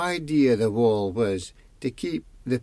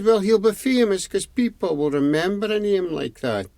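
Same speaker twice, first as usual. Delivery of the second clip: wife voice; 8 semitones higher than usual. A narrator's reading. Wife answers proudly.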